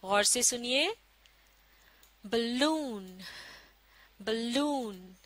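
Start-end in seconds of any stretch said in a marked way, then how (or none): 2.2-5.1 balloon with a quick ब and a drawn-out, stressed लून; teacher's model balloon, second half stressed; stress on second syllable. Shown as a demonstration. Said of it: "दूसरे हिस्से को जोर देकर बोला जा रहा है".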